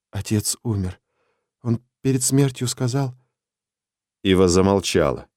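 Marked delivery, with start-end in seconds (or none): 0.1-3.1 put-on soft hushed girl; quiet girl's voice. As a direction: say it softly, holding back grief. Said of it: "тихий девичий голос".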